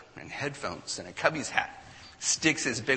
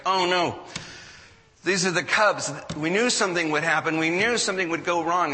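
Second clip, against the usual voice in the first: higher, louder fearful wail of doom; mock panic; 5 semitones higher, 6 dB louder than usual. Cubs fans panicking that the curse struck, loud fearful wail. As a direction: play the doomed fan who knew it would happen. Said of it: "It's like".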